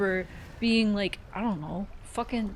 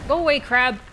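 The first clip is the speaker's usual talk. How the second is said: loud, exasperated shooing; shouting it away; 6 semitones higher, 7 dB louder, faster than usual. Real, not acted. Player to a crab in the game, shouting it away. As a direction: shout to shoo a pest off.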